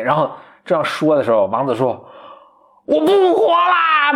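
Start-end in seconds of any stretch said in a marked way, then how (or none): 2.9-4.1 high, slow melodramatic wail; hammed-up cry of despair; mock tragic prince. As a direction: overblown dying prince, high and drawn out. Prince throws himself on sword.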